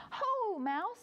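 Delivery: high, drawn-out hail to a friend; lightly voiced hare calling hello; high swooping storybook hello; hare's greeting.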